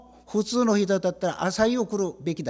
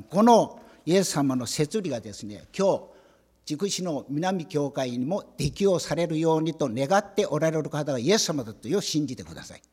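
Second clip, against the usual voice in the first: usual speaking voice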